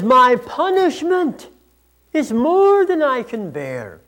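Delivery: loud, high, slow, drawn-out wail of protest; Cain's complaint. Acted. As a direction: cry out to God in aggrieved complaint. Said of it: "my best attempt at capturing the, the complaining".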